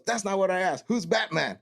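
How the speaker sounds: high-pitched, insistent interrogation; drill instructor demand. Retold or imitated. Instructor rejects recruit's answer.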